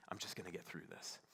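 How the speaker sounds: low, soft echo of oneself; hushed self-quote. Retold or imitated. Husband hearing his own words, low and reflective. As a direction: repeat it quietly, as it sinks in.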